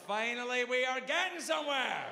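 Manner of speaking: high, rising triumphant cry; hammed-up elation. Patient who thinks treatment is working, hammed-up elation. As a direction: build to a jubilant high exclamation. Acted elation at absurd wisdom.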